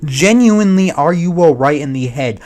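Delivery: loud, exasperated rant at the streamer; frustrated outburst. Real, not acted. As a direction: blast him with disbelief. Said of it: "it makes me frustrated".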